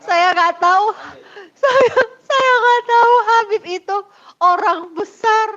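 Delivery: high-pitched plea of ignorance; insistent protest. Real, not acted. Wife insisting she did not know the Habib, high-pitched protest.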